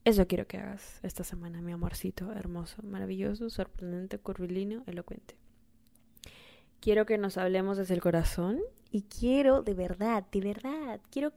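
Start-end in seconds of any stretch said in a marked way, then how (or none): 1.6-5.4 much softer, fond murmur; softly doting on her listener; tender pet names. Real, not acted. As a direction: coo each compliment gently.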